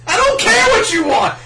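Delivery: high, loud exasperated yell; furious Chopper yell. Acted. Chopper yelling at the juice dude, high furious yell. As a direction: scream it back at him. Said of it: "Chopper's angry at this".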